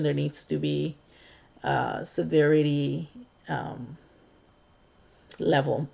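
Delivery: normal talking voice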